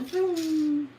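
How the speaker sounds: mock engine vroom. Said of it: "it goes".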